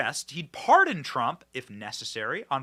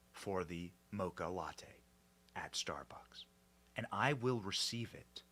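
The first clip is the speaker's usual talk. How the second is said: slow, lower, whispery pompous anchor drone; mocking news anchor voice; 7 semitones lower, 6 dB quieter, slower than usual. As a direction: drag each word out like a self-important TV host, fading to a whisper. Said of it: "do they talk like that in real life?".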